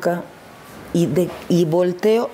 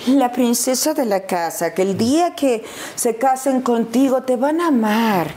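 doting parents; 5 semitones higher than usual. Retold or imitated. Parents raising their princess daughter, doting and sing-song. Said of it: "mis papás me educaron así de".

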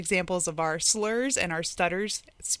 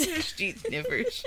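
slow tipsy retry; 7 semitones higher, 4 dB quieter, slower than usual. Real, not acted. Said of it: "I can't talk".

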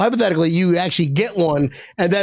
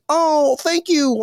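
mock polite thanks; 6 semitones higher, much slower than usual. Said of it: "I'm like".

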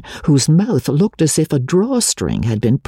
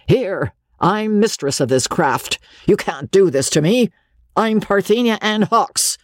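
lightly voiced stern old woman scoffing at death; defiant old matriarch; 7 semitones higher than usual. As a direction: scoff proudly, refusing to submit. Dead Parthenia's imagined defiance.

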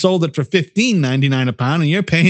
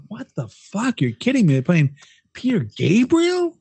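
mock disbelief; 6 semitones higher than usual. Shopper hearing [name] in the store, mock disbelief. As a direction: play it like you can't believe your ears. Quoting shoppers' reaction to music.

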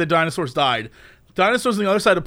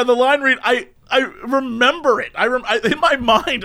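normal talking voice

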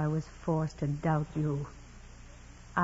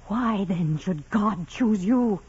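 anguished outburst; 3 semitones higher, 6 dB louder, slower than usual. Acted. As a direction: let the jealousy break out. Jealous nun's outburst.

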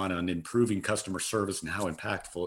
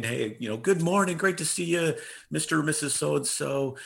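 higher-pitched, eager front-desk welcome; enthusiastic greeting; 4 semitones higher than usual. Retold or imitated. Clinic staff welcoming patients as they walk in, enthusiastic. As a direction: greet them like a long lost friend, pitched up. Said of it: "we did it with enthusiasm".

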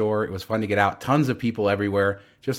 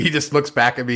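ordinary talk, nothing marked

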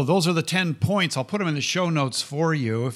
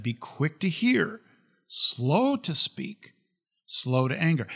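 slow, deliberate recitation; measured quoting of James; slow scripture quote; 3 semitones higher, slower than usual. Real, not acted. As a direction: let each phrase land slowly. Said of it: "Remember what James said".

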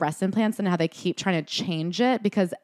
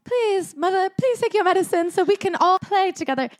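put-on high, loud, pleading little-child voice; mock the sugary children begging their mother; sickly sweet kid pleading "Mother, please"; deeply earnest child; 13 semitones higher, 4 dB louder than usual. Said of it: "deeply earnest".

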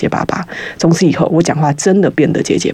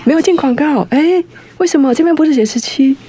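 flustered, much higher pitch; surprised; 11 semitones higher than usual. Real, not acted. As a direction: caught off guard on live air. Host shocked that the ad break is not yet.

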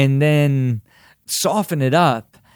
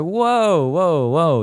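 startled whoa; 5 semitones higher, much slower than usual. Retold or imitated.

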